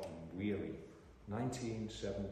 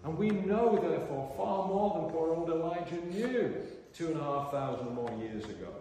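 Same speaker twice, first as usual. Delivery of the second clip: high, loud pulpit voice; emphatic, raised preaching; forceful preaching; 8 semitones higher, 9 dB louder than usual. Real, not acted. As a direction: proclaim it to the congregation.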